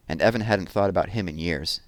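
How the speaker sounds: ordinary delivery, nothing marked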